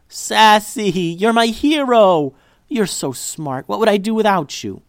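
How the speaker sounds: high, loud joyful praise; overjoyed. Acted. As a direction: gush over the dog.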